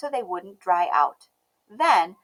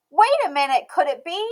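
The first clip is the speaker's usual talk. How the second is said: gasped wonder; 6 semitones higher, 10 dB louder than usual. A narrator's reading. Narrator gasps in wonder.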